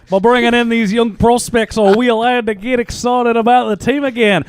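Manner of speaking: high-pitched, hammed-up Australian accent; bad Australian accent. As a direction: read it in a badly faked Aussie accent. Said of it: "I'm just gonna do a bad Australian accent".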